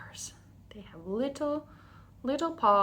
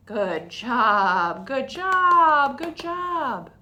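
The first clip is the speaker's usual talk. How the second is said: cheery praise; 3 semitones higher, 8 dB louder, much slower than usual. Real, not acted. Praising kids after song.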